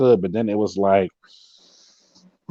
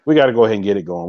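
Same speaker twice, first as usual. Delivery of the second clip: normal talking voice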